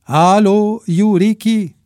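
a touch of a South American caller; South American hoarse voice. A narrator's reading. Vicente calling Ricky on the phone, lightly South American. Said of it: "o voce răgușită într-o engleză sud-americană".

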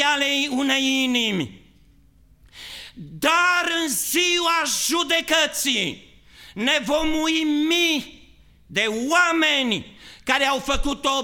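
high, loud shouted preaching; preaching at full force. Acted. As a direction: thunder it from the pulpit. Predică în forță. (predică strigată, cu toată forța)

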